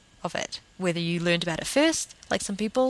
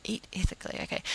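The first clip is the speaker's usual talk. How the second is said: whispered under the breath, doubtful; skeptical inner thought; 7 dB quieter, faster than usual. Retold or imitated. Quietly doubting a listener's claim of eating ethically, whispered. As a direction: breathe it to yourself in disbelief.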